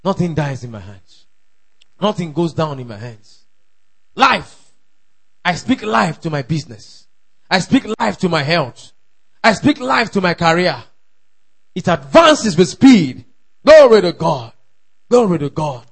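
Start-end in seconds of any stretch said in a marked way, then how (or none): 4.1-15.9 forceful declarations building to a shout; rousing pulpit proclamation; full-force preaching. Real, not acted. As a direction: declare each line firmly, growing louder.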